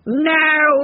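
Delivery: loud, high-pitched order; blustering bull's shout; sharp command. A narrator's reading. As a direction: order it out at once. Bull orders dog out.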